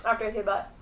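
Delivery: talking with food in mouth; mouth full. Real, not acted. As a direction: speak round a fresh bite. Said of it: "I always take a bite".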